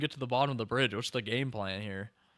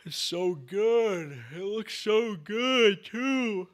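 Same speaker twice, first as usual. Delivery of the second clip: awed gushing; 7 semitones higher, much slower than usual. Real, not acted. Awed by the battle footage.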